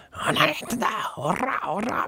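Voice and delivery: imitating a hot-tempered director barking orders; 怒鳴る監督の真似; mock angry yell. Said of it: "怒涛型の人もいるんです".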